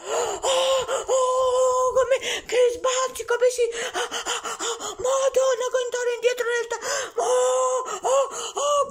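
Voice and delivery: high squeals through a bumpy ride; on a roller coaster. Pretend. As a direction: squeal as the ship jolts. Wild ride in the spaceship. (sulle montagne russe)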